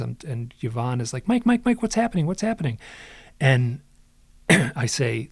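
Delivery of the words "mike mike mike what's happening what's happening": high, urgent retold calling of a name; urgent alarm. Narrator voicing therapist interrupting his screaming, urgent and alarmed. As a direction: imitate someone urgently breaking in, pitched up.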